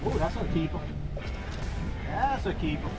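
ordinary talk, nothing marked

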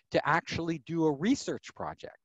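ordinary talk

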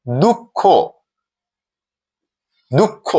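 দুঃখ as "dukkho", held double k; pronunciation demo. Shown as a demonstration. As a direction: say দুঃখ with a rising final "o". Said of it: "ওকার রূপে উচ্চারিত".

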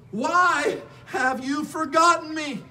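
high, slow anguished cry to God. Acted. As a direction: cry it out, stretching the words. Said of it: "and he's crying out".

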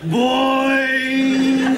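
loud, high, held imitated boing; drawn-out cartoon spring noise. Zebedee bouncing into the story, loud comic boing.

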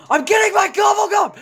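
high frustrated gamer yell; angry shout. Real, not acted. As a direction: yell it at the game.

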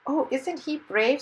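high, slow, admiring coo; admiring princess. A narrator's reading. Princess to hero's wife about his courage, admiring.

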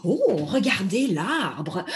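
normal talking voice

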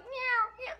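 high, loud cat yowl imitation; cat meowing. Woman acting out her cat held by its throat, meowing. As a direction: meow like a cat grabbed by the throat. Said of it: "She was holding him by his little throat".

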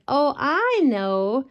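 slow dawning realization; realizing. Acted. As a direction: draw it out, it dawns on you. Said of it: "Missus Bear said".

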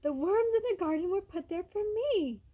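high, boastful bird; twittering bird. A narrator's reading. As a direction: chirp it out from high in a tree. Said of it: "the bird twittered from high in a tree".

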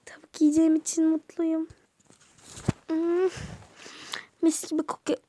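ordinary delivery

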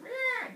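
very high imitated baby noise; high, short put-on infant cry; baby's squeal. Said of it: "The baby's like".

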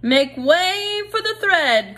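high, slow call to clear the way; a mouse herald, high and drawn out; crying out. Acted. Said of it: "cried a mouse".

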